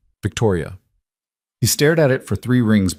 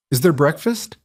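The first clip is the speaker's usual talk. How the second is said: put-on little girl voice; 7 semitones higher than usual. A narrator's reading. Sleepy child asks for breakfast.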